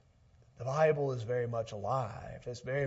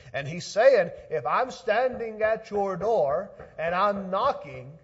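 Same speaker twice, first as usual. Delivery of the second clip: raised, louder preaching voice; 8 semitones higher, 11 dB louder than usual. Acted. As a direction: preach it out to the congregation. Preaching at full force.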